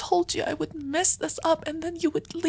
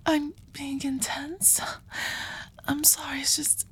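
hushed and slow, near tears; soft teary ASMR whisper; tearful intimate whisper; 2 semitones lower, 4 dB quieter than usual. Acted. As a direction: whisper as if overwhelmed with relief. Tearful whisper, then pizza.

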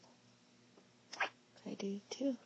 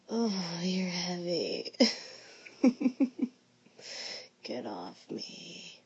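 playful groan; 3 semitones lower, 5 dB louder, slower than usual. Acted. Partner lying on top.

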